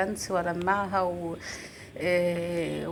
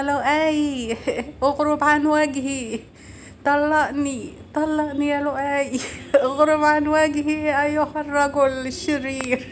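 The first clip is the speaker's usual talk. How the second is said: high, loud, drawn-out mock soap-opera heroine; daughter's melodramatic play-acting; 7 semitones higher, 5 dB louder, slower than usual. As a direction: imitate a child acting out melodrama, laughing. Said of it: "وتقول لها".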